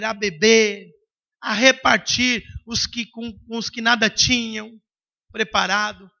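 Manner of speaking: ordinary talk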